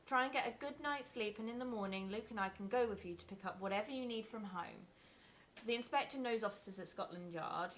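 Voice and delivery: usual speaking voice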